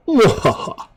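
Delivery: high gleeful villain's mwahaha; evil cackle. Pretend. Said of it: "I don't normally mwahaha".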